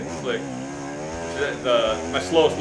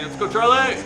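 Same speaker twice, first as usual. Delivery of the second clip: cheering shout; 8 semitones higher, 4 dB louder than usual. Retold or imitated. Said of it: "that was Rick saying".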